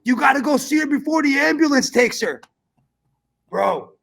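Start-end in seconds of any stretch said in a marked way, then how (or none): 0.0-2.4 loud, high frantic wake-up shout; dad yelling. Retold or imitated. Dad shouting his son awake, high and loud. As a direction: burst in yelling at the sleeping son. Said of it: "my dad talks like that".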